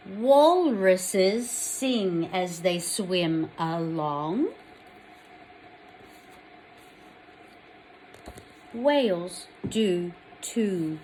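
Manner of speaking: slow, loud, clear picture-book reading; story reading. Acted. Teacher reading a picture book to children, slow and clear. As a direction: read each page slowly to little ones.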